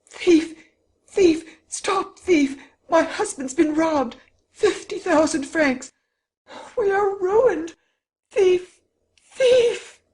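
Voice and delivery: very high, loud yells for help; yelling. A narrator's reading. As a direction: scream for the police at full pitch. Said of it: "She uttered absolute yells".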